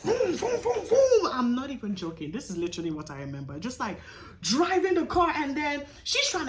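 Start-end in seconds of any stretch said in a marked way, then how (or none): 0.0-1.3 high, playful boom boom through a laugh; high gleeful mock booms, laughing; laughing boom sounds. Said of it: "my mom is like".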